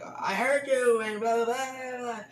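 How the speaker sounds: high mock yell with blah blah; imitating the driver screaming at the lady, high. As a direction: yell it high to make him sound unhinged. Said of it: "the guy kept screaming at her".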